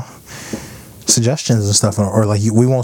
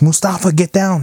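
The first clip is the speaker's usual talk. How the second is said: higher-pitched neighbour's call; 7 semitones higher than usual. Retold or imitated. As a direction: call up a warning to a kid. Elderly neighbour calls out warning.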